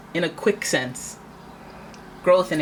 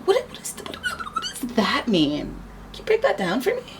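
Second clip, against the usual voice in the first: mock confusion. Querent questioning the swindler, mock bewildered. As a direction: play dumb to make them explain. Pretend confusion over contract terms.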